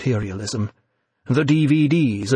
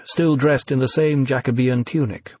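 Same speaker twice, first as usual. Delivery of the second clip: plain reading voice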